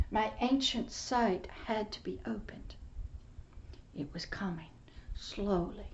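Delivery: breathy hushed testimony; hushed whisper. Real, not acted. Preacher whispering his testimony to the congregation. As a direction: whisper it like a sacred secret.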